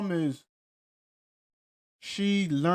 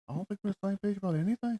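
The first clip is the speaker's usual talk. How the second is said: quick, higher put-on Irish lilt; hamming up an Irish accent for a laugh; mock Irish accent; 5 semitones higher, 3 dB quieter, much faster than usual. A friend repeats Dempsey's line in a put-on Irish accent. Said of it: "What accent is this?".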